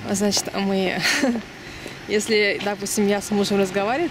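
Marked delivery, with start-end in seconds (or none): none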